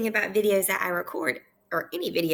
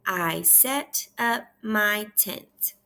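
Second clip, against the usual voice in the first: very slow, word by word for repeating; measured beginner-reader pace; slow read-aloud; much slower than usual. Shown as a demonstration. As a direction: model the sentence slowly, pausing between words. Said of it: "I'll read the first one".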